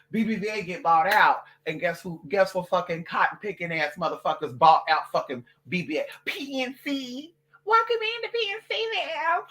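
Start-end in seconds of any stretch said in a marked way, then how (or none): 6.2-9.4 much higher, chirpy customer-service voice; bank greeter. Retold or imitated. Bank greeter welcoming her in, a high chirpy service voice. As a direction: sing out a fake cheery welcome.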